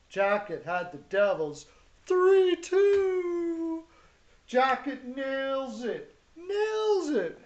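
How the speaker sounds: high, slow, stretched-out crowing; excited announcer. Real, not acted. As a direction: call it like a triumphant announcer. Announcing a pick winner.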